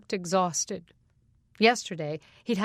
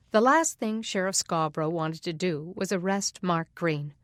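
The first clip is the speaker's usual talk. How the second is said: ordinary delivery, nothing marked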